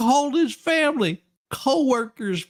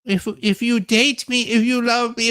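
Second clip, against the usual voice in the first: ordinary talk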